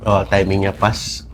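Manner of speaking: normal talking voice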